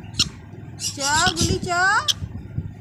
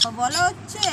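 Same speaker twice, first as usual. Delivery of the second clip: usual speaking voice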